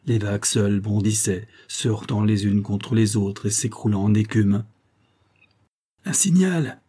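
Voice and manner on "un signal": high-pitched lookout's call. A narrator's reading. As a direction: call it out to the deck. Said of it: "cria le matelot". (crier une annonce)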